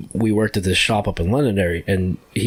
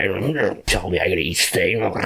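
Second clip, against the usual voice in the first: normal talking voice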